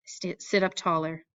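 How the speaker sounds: ordinary delivery, nothing marked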